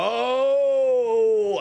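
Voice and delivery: loud, high drawn-out oh; a sudden loud oh of realisation; surprised realisation. Real, not acted. Host suddenly understands.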